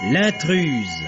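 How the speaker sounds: ordinary talk, nothing marked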